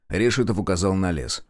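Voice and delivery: ordinary delivery, nothing marked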